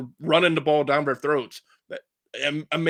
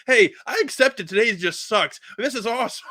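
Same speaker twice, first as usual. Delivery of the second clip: mock manic; 6 semitones higher than usual. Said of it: "I got the manic side".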